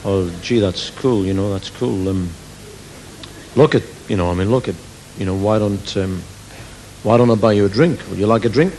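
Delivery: a touch of crooner; smooth chat-up voice. Acted. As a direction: play it cool and low. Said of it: "deep romantic voice".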